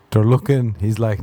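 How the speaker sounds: normal talking voice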